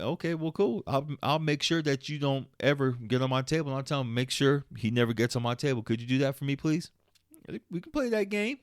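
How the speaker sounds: mock sweet. Fake sweet retort to client.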